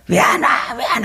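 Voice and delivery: lightly acted whiny tantrum; half-hearted bawling-kid imitation; mock wailing child. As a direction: imitate a child starting to cry and fuss. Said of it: "하고 막 울기 시작하고 막 악을 써요".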